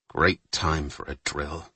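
low, quiet dry sarcasm. Acted. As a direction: mutter it wryly, slowly. Captain's dry aside.

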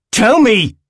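high, loud explosive order; shouting. A narrator's reading. Willem gets no reaction from his friend, shouting. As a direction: shout the demand at him. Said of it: "he shouts at Jude again".

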